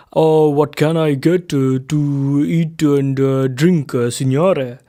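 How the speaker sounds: put-on waiter's light Italian accent, hesitant; a hint of an Italian waiter searching for English words; heavy Italian accent. A narrator's reading. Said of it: "in a heavy Italian accent".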